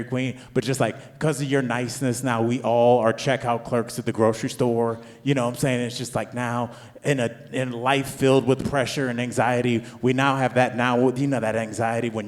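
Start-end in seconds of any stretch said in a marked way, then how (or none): none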